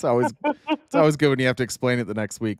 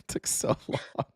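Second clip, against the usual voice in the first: whisper; 8 dB quieter, slower than usual. Real, not acted. Host laughing after bad pun.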